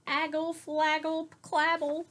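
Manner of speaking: put-on high toddler babble; lightly voiced toddler shouting gibberish; toddler yell. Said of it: "she just yelled".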